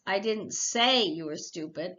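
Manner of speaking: I didn't SAY you were stupid, say stretched; stress on say. Shown as a demonstration. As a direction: say lifted and leaned on. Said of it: "Listen to your intonations".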